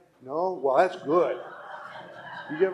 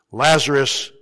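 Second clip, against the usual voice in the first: calls the name out, louder; loud cry; 11 dB louder, slower than usual. Acted. Jesus calls Lazarus from the tomb, loud cry. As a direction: call into the tomb, full voice. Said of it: "he cried out in a loud voice".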